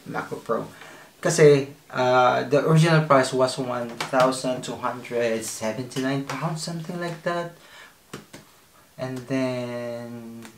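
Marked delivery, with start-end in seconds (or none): none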